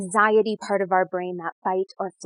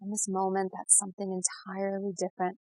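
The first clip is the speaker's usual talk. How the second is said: guided meditation; 4 dB quieter than usual. Real, not acted. Guide leading listeners to settle and breathe, soothing meditation voice. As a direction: ease the listener gently into calm, unhurried.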